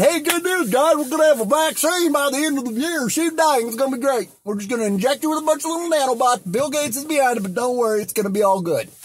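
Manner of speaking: hammed-up reassuring pitch about the vaccine; Trump impression. Vlogger mocks Trump promising a vaccine, high and breezy. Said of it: "He's saying".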